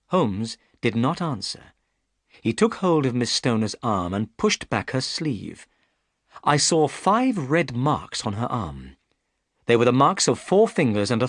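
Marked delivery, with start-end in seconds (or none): none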